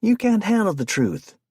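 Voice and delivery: high, loud dramatic shout; loud theatrical shout. A narrator's reading. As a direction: bellow the movie line after her. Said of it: "he shouted".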